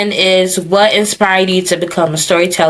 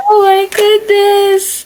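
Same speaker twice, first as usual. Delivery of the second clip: high laughing cry; 13 semitones higher, slower than usual. Real, not acted. Laughing at the story.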